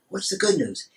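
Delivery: high excited ask; eager question. Acted. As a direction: jump in eagerly. Excited friend asks ghost.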